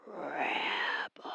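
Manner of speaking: a rasping voice on the phone; rasped. A narrator's reading. Caller on the phone rasps at Lisa, rasping. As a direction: rasp the word out, drawn long. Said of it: "Rasped a voice".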